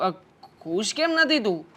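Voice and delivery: normal talking voice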